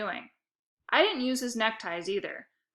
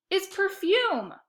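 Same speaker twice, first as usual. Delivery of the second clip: very high, slow screech; 12 semitones higher, 4 dB louder, much slower than usual. A narrator's reading. Lexi screeching at her brother about perfume. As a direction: shriek it in protest. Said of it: "Lexi screeched".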